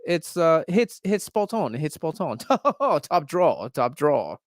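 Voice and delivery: high, hammed-up British accent. Cohost asked for his British accent, so hammy British. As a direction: ham up a chirpy British bloke. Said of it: "let me hear your British again one more time".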